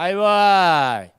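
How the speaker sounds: loud, slow stalling "ahí va"; lazy kid reply. Retold or imitated. Son answering his parent, slow lazy stalling.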